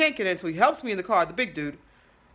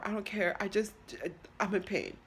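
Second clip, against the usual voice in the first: normal talking voice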